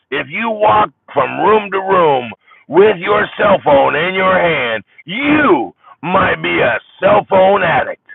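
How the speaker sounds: high, loud, slow comic punchline voice; Jeff Foxworthy. Retold or imitated. Jeff Foxworthy doing a stand-up bit, loud and slow. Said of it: "I went Jeff Foxworthy on you".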